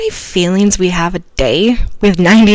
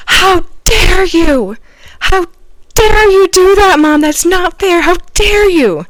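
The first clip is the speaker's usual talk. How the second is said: very high, loud imitated kid's tantrum; screaming child; 12 semitones higher, 7 dB louder, slower than usual. Daughter screaming at mom over confiscated custard. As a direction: shriek it like a furious little girl.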